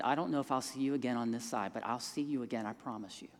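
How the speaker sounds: a tender goodbye that trails off; quiet farewell. Retold or imitated. Farewell promise to dying man.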